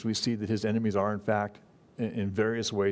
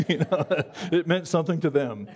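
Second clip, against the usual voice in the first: higher, talking through real laughter; laughing; 6 semitones higher than usual. Real, not acted. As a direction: chuckle through the words. Laughing after his own joke.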